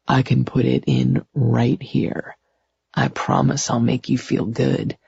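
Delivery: low seductive murmur; seductive coaxing. A narrator's reading. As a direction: coax in a softened male voice. Man seductively coaxing his lover.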